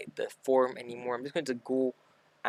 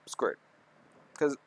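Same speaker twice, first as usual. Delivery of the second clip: hiccup breaking the words. Real, not acted. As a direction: talk through a hiccup. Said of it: "sorry, I'm hiccuping".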